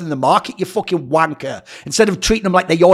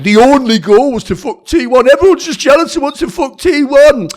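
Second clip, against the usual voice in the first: higher, louder put-on sneering voice; mocking voice; 5 semitones higher, 4 dB louder than usual. Streamer parodying the article's framing, sneering put-on voice. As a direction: ham up the biased framing.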